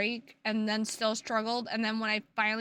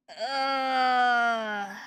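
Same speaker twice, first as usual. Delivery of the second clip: fake anguished groan; 5 dB louder than usual. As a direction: groan like it hurts.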